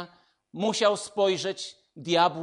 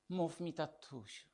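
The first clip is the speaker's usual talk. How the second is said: soft, gentle invitation from a loving father; tender fatherly voice; 5 semitones lower, 9 dB quieter than usual. Retold or imitated. God inviting his child to call him daddy, soft and tender. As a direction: say it softly, like a dad calling his child close. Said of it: "Pan Bóg mówi do ciebie".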